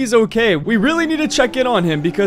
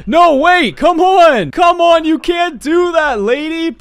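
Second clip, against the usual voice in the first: loud, high pleading yell; begging the cop loudly to stay; desperate shout; 7 semitones higher, 4 dB louder, slower than usual. Acted. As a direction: shout after her not to leave. Cop walks away, he protests.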